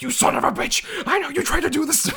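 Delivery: high, furious yelling accusation; angry accusing yell. Retold or imitated. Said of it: "He's just like".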